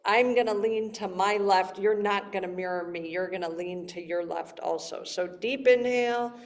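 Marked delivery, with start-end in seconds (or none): none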